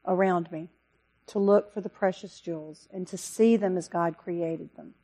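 ordinary talk, nothing marked

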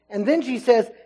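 ordinary talk, nothing marked

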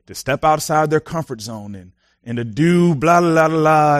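ordinary talk

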